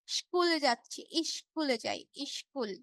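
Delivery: Dinajpur "স্কুল" said as shkul or ishkul; Dinajpur accent. Retold or imitated. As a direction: imitate Dinajpur speech, sh for the s in "স্কুল". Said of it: "দিনাজপুরের মানুষ যারা আছে". (দিনাজপুরের টানে "ইশ্কুল")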